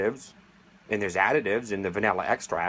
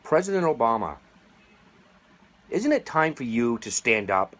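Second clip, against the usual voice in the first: calling out; 3 semitones higher, 3 dB louder than usual. Real, not acted. Calling out to the president.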